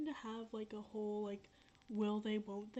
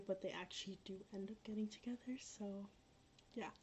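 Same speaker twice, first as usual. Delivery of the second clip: whisper; 8 dB quieter than usual. Real, not acted. Spoiler told quietly.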